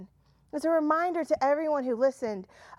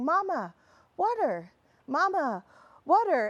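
put-on higher-pitched toddler calling for mama; tiny pleading toddler voice; little tiny voice; 3 semitones higher than usual. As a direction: imitate a small child's plea, each word falling. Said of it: "I hear this little tiny voice".

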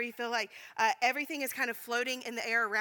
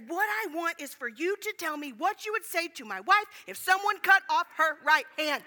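emphatic, raised-voice insistence, building louder; louder angry reply; 5 semitones higher, 6 dB louder than usual. Retold or imitated. Imitating friend talking over him in a maddening moment, loud. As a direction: hammer it home, louder toward the end. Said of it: "he responds louder than me".

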